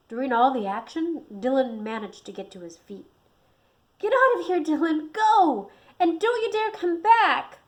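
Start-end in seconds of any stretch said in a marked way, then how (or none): 4.0-7.6 high, loud urgent scream. A narrator's reading. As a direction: scream at him to run. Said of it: "The cat screamed at him".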